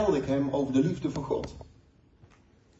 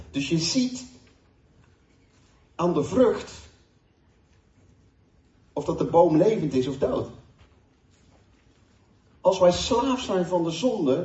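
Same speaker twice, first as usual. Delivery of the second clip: raised, emphatic sermon voice with long pauses; preaching; 4 dB louder than usual. Acted. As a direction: preach it to the congregation, building and falling. Preaches to congregation about sin.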